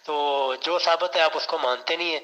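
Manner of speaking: loud, heated debate shout; angry shouting. Real, not acted. Debater shouting at his opponent in frustration, loud and angry. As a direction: shout him down, fed up and angry.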